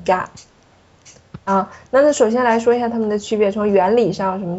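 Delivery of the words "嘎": "嘎" with no puff of air at the start; unaspirated ga. Shown as a demonstration. Teacher giving the required reading, "嘎" without aspiration. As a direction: say "嘎" with the k softened to unaspirated. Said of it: "要必须读成嘎".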